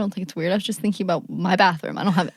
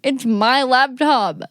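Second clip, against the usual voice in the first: indignant; 5 semitones higher, 5 dB louder, much slower than usual. Real, not acted. Outraged at confiscation.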